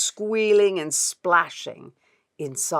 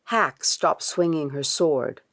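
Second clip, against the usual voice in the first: ordinary delivery, nothing marked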